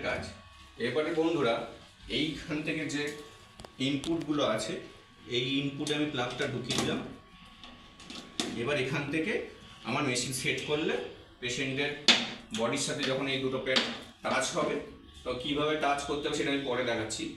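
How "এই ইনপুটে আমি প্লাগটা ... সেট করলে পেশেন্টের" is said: usual speaking voice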